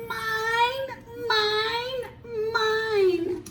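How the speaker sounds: put-on high, drawn-out whiny toddler voice; Grumpy Toad whining to keep his truck. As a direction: whine it like a toddler hogging a toy. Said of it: "He starts to whine".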